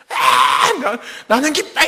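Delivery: high, full-voiced yell of joy; loud hammed-up cheer at a high pitch; imitated shouting. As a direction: imitate kids screaming out a praise line. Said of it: "막 소리를 질러".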